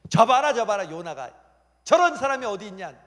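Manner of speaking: high, very loud proud exclamation; hammed-up applauding delight; delighted God. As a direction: crow over a favorite to the whole room. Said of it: "하나님 박수 치시는 거예요".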